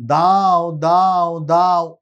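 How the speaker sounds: put-on long stretched words, coaxing a toddler to speak; drawn-out words. Shown as a demonstration. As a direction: pull every word out slowly for a child to copy. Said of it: "লম্বা টেনে টেনে বলবো".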